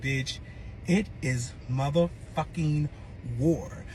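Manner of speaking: low, slow, deliberate threat; slow declaration of war. Retold or imitated. Woman recalls declaring war on her ex, slow threat. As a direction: declare it word by word, pitched low.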